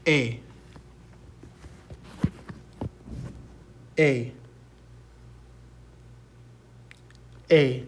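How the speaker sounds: E said like "ay", falling; Spanish vowel name. Shown as a demonstration. Teacher models the Spanish vowel for students, clear demonstration. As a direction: say E as "ay", each one apart. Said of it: "the way you say E in Spanish is".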